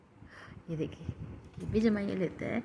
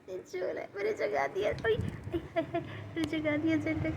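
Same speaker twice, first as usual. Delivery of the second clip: high-pitched dismay; 8 semitones higher than usual. Real, not acted. Woke sleeping pet, dismay.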